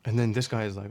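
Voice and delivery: usual speaking voice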